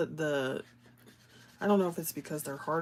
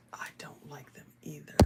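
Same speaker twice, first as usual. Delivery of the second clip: confiding whisper; soft agreeing whisper; 11 dB quieter than usual. Real, not acted. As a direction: whisper it like a secret. Confiding aside to chat.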